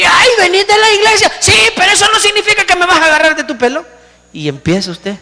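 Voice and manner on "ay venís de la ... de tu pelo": mock shouting wife. Wife shouting back at her churchgoing husband, mocking. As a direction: shriek it back at him, sarcastic.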